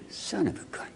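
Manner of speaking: awed whisper to himself; hushed amazement alone in the car. Retold or imitated. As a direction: breathe it out in wonder. Driving home, amazed at a year.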